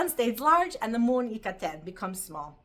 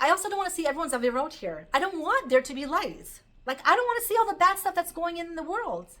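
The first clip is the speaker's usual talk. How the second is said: higher, louder complaining sun; acted protest; 7 semitones higher, 5 dB louder than usual. The sun objects to shining on wrongdoing, protesting. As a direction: lightly play the sun objecting, voice raised. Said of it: "And also the sun was like".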